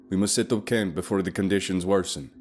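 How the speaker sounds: lightly voiced guide announcing to the group. Guide Leif announcing camp to the group, mild announcement. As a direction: call it out to the group. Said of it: "he announced".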